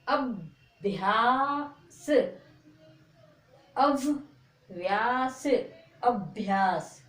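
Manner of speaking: अभ्यास drawn out very slowly, the half भ said quickly into या; अभ्यास modelled slowly and repeated for pupils; slow teaching demo. Shown as a demonstration. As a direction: sound out अभ्यास slowly for beginners. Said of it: "तो कैसे पढ़ते हैं?".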